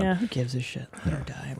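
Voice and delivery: low mock callous voice.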